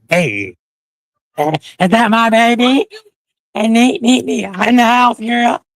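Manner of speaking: high, slow, delighted call to a friend; excited greeting. Real, not acted. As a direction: call out to her with joy.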